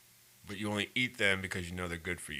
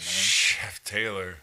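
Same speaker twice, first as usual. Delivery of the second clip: TAYLOR after a long drawn-out "Chef"; mock-grand title; 2 semitones lower, much slower than usual. Friend bestows the new title Chef Taylor, mock-grand and drawn out. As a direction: draw out "Chef Taylor" slowly, savoring the title.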